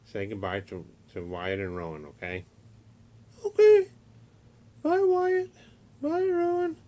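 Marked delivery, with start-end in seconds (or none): 3.5-6.8 very high, louder squeaky puppet voice; monkey puppet voice. Acted. Uncle voicing Fred the monkey puppet for nephews, very high squeaky voice. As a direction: squeak it out like a little toy monkey. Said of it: "He's a monkey".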